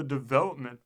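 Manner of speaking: put-on "development" with a marked accent on the "vel"; stress on VEL. A narrator's reading. Ambassador correcting the president, "development" pointedly stressed on "vel". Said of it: "with a marked accent upon the vowel".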